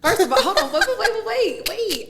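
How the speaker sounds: high laughing wait wait. Real, not acted. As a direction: laugh while stalling. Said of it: "What are you eating?".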